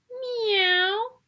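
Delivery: high, rising meow; the cat appearing with a meow. A narrator's reading.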